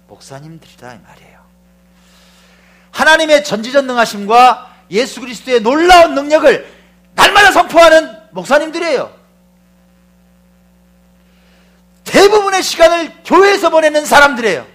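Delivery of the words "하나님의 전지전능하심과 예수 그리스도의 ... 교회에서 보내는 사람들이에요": loud, high preaching at full force; fiery preaching. Acted. Preacher thundering to the congregation, loud and high. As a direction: proclaim it to the whole church.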